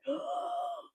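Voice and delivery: a very high, sudden gasp; imitated gasp. Retelling her husband's last breath, a high gasp. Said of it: "I heard".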